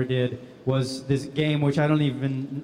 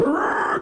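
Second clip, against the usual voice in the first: high goblin growl-screech; imitated high-pitched goblin cry; goblin noise; 12 semitones higher than usual. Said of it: "What does a goblin sound like?".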